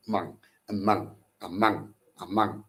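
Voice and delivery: "among" repeated with the weight on MONG; second-syllable stress. Shown as a demonstration. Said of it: "stress on the second syllable".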